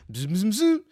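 high, loud buzzing noise; imitated phone buzz. Podcaster mimics his phone going off, urgent buzzing.

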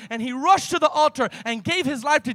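loud, driving sermon delivery that builds to a shout; full-force preaching. Acted. Pastor to the congregation, telling the healing in a loud preach. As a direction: preach it to the rafters, pushing every line.

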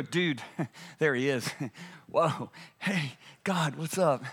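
low, soft, chuckling buddy talk; mock over-casual greeting. Isaiah greeting God like a pal, mock casual. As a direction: toss it off chummily with little laughs.

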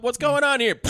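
high, loud official demand; mock demand. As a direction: bark it like a cop walking in. Cop arrives on scene, retold.

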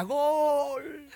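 high playful "¡Gol!" with a laugh; laughing mock goal cry. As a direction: cheer like a soccer fan at a ballgame. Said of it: "gritaba la gente decía". (grito de gol en broma, agudo y con risa)

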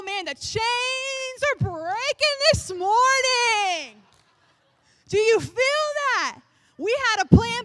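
loud, high, fired-up preaching; fervent preacher shout. Acted. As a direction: preach it at full force. Mock prophetic intensity builds.

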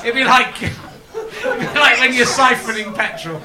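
high, loud, slow words through laughter; cracking up at his own outrageous joke; laughing shout. Real, not acted. Host laughing at his own joke, laughing shout.

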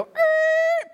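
loud high hail to a friend; calling out. Retold or imitated. Friend pulling over to hail Boog, loud high call. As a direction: shout a friendly hey from the car.

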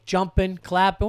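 ordinary delivery

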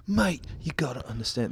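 mock English accent, lightly done; a hammed-up Londoner, barely put on; lightly faked English accent; bad English accent. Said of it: "This is a bad English accent".

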